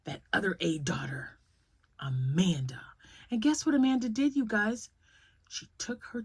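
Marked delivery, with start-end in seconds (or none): 0.1-2.8 conspiratorial whisper. Real, not acted. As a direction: whisper the name like a secret. Conspiratorial naming of the daughter.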